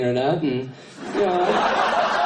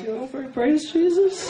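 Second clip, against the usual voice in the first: awkward praise; 10 semitones higher than usual. Retold or imitated. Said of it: "I'm like".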